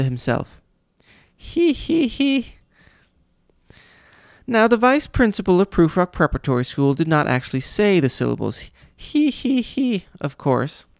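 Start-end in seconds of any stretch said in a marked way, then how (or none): 1.4-2.5 much higher squeaky wheezy cackle; high wheezy cruel giggle; squeaky cruel laugh. A narrator's reading. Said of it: "The laugh was squeaky, and it was wheezy". 9.3-10.1 high squeaky he he; a quick high cackle; light high giggle; squeaky laugh. A narrator's reading. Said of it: "these words mean somebody was laughing".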